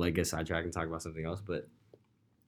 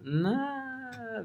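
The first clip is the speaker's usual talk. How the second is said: high-pitched scoff; 3 dB quieter than usual. Real, not acted. Refusing to babysit drunk friends.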